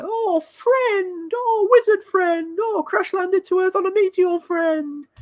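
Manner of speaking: very high falsetto taunt; singsong mock-gushing falsetto; Inbetweeners-style mocking. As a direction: squeal it in a silly falsetto, stretching the words. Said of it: "thinking of In Between as like".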